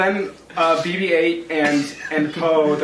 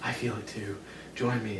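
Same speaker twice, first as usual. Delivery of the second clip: low, very soft villain's voice; hushed, deep imitation of a dark tempter; Kylo Ren; 6 semitones lower, 13 dB quieter than usual. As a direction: murmur it low, like a remembered voice. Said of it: "kind of remembers Kylo Ren talking".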